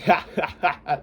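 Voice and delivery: a laugh pushed from the throat; full laugh. Shown as a demonstration. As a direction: laugh with effort, as shown. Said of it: "you wanna laugh where it's like".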